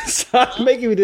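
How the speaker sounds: high-pitched, breaking into giggles; embarrassed, laughing plea; giggly protest. Real, not acted. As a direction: protest while trying not to laugh. Prankster cracking up mid-call.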